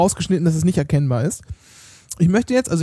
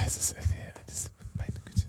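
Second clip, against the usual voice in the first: whispered; 16 dB quieter than usual. Real, not acted. Exasperated aside.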